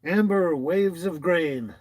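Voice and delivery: loud, slow announcement of a name; announcing. Shown as a demonstration. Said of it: "really cool handle or title".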